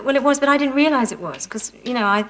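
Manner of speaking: normal talking voice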